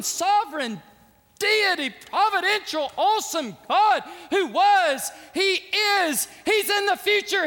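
loud, high, building proclamation; full-force preaching. Acted. Preacher proclaiming God's power to the congregation at full force. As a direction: thunder out God's greatness.

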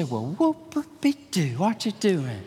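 mock unimpressed drawl; lightly voiced skeptic; sarcastic listener. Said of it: "You say".

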